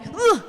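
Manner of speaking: high, short "ugh" of shock; mock horrified gasp. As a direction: imitate Daddy's startled overreaction. Imitates father's shocked reaction.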